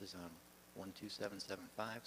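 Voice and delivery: usual speaking voice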